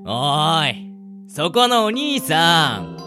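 slow, stretched calling-out; hailing fortune-teller. Acted. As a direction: hail a passer-by with drawn-out vowels. Fortune-teller hails passer-by. (占い師の間延びした呼び込み)

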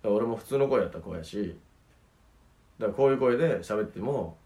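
low, soft, subdued everyday voice; his ordinary voice. Shown as a demonstration. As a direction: drop to the dull everyday voice on purpose. (低く小さい地声)

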